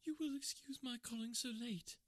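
distressed visitor. Acted. Late visitor apologising to the couple, high anxious voice. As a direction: play the veiled lady, anxious and polite.

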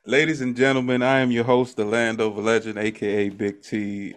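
big show-opener delivery; podcast host intro. Acted. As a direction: announce yourself to the crowd. Podcast host's show opening.

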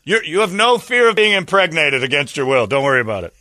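high loud mocking rant; mocking heckler. Heckling woman at protest.

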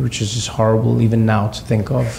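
ordinary delivery

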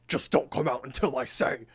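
mock insistent cartoon voice; emphatic put-on insistence; insistent order. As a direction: repeat the order firmly, in character. Pie repeats order after being questioned.

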